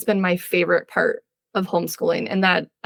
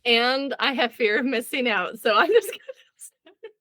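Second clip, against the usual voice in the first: higher, breaking into laughter; laughing confession; 4 semitones higher than usual. Real, not acted. Host asked why she joined, confessing it while laughing. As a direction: confess it through giggles.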